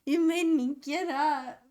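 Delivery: slow, loud, high-pitched mock baby protest; grumpy baby voice. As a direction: speak as the baby, whining loud and high at mom. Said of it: "He's like".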